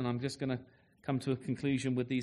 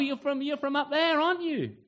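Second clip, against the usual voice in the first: pitched way up, teasing; mocking accuser; 10 semitones higher than usual. Bystander teasing Peter about his accent, high mocking voice. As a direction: needle him about where he's from. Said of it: "one of those funny northern accents".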